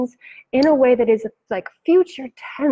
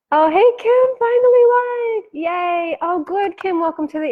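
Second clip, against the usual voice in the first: high, loud delighted hello to a viewer; light up as a friend joins; squealing a happy welcome; excited greeting; 8 semitones higher, 4 dB louder than usual. Real, not acted. Greeting a viewer in the live chat.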